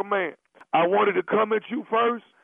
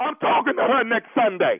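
raised, insistent pitch; mock defiant; 5 semitones higher than usual. Caller insisting to angry husband about his wife, mock defiant. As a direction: dig in stubbornly, voice climbing.

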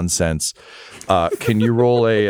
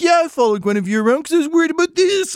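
unhinged rant; faster than usual. Acted. Broke noble ranting at the investigators, high and unhinged. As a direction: lose it completely, voice cracking upward. Accused NPC continuing frantic rant.

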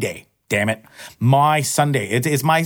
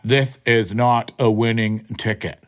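mock machine voice; 4 semitones lower, slower than usual. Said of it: "all I got was a big fat".

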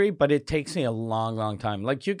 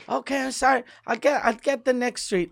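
high, apologetic panicky driver voice; flustered driver; 9 semitones higher than usual. Retold or imitated. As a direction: play the lost driver saying sorry.

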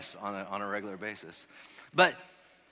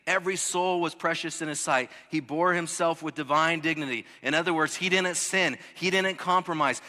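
higher, louder preaching climb; full-force preaching; 6 semitones higher, 10 dB louder than usual. Acted. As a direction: preach it out with rising fire. Preacher building to a peak.

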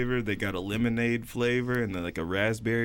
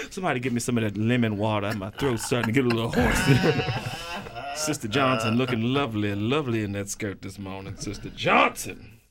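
preacher's aside. Acted. Same preacher, aside to congregation.

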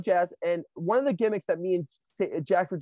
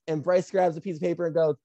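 ordinary talk

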